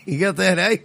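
put-on Australian accent. Mock Australian wedding guest.

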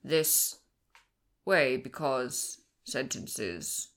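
broken halting alien speech, very low and slow; imitating alien. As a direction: mimic the alien's choppy sentences. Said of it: "acted alien speech".

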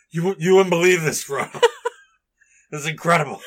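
loud, excited tavern storyteller; hammed-up awestruck drunk hobbit; drunk, amazed Merry. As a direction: gush it to a friend, laughing. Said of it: "Merry's just like".

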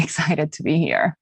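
normal talking voice

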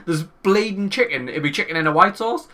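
high-pitched mock indignation; retold fuming. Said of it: "I was fucking fuming".

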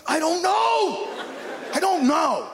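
high, slow helpless I don't know; lost teen. Retold or imitated. Teen asked about his future, high and helpless. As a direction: shrug it out, baffled.

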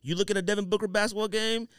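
usual speaking voice

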